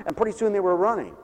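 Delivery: normal talking voice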